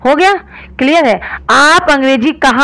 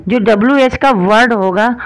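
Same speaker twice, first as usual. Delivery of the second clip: ordinary talk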